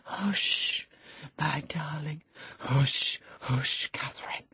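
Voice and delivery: soft, whispered soothing; calm your darling under your breath; tender hushing. Acted. Lover soothes frantic woman.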